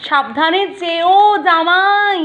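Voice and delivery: drawn-out, high and loud teasing call; teasing farewell. Real, not acted. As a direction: stretch it out slow to tease the son-in-law. Teasing farewell to brother-in-law.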